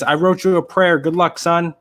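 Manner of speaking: a chipper priest waving him off; priest, voiced. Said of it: "Priest says".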